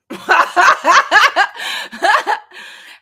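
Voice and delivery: bursting high laugh; loud laugh. Real, not acted. Streamer laughing to her chat, loud and high. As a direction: let out a big real laugh.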